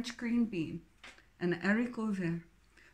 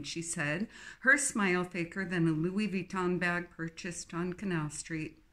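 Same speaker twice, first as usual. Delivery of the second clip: usual speaking voice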